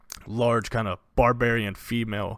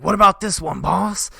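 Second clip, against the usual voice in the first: higher, louder eager lackey; bandit henchman; 9 semitones higher, 9 dB louder than usual. Acted. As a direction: ask the boss for orders. Henchman asks the boss.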